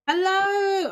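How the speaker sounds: high, excited hello to the pups; bright squeal of hello to a new puppy; pet greeting. Real, not acted. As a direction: greet the puppies like a doting owner. Greeting puppies in game.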